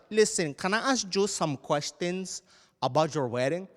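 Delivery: put-on thick Latin accent, demanding mother; stern Spanish-accented mom.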